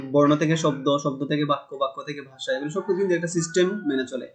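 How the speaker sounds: ordinary talk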